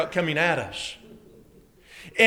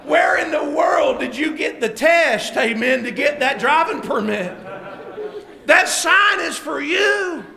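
high, raised-voice rebuke; exasperated scolding; 6 semitones higher, 3 dB louder than usual. Retold or imitated. Father to son about the driving test, exasperated scolding. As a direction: berate him, stretching the final words.

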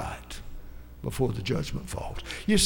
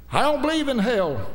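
mock scoffer; 9 semitones higher, 4 dB louder than usual. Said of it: "Somebody says".

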